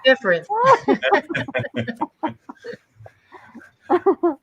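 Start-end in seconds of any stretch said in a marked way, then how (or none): 0.5-2.7 a high, bright burst of laughter. Real, not acted. Actor laughing high as the show wraps. Laugh after the read-through.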